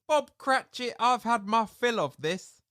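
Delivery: a hint of a cranky old employer; mock annoyed Scrooge. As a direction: fake scolding boss, a little high. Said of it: "He pretends that he's annoyed".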